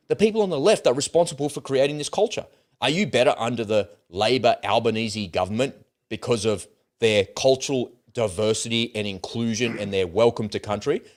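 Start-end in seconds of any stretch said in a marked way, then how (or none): none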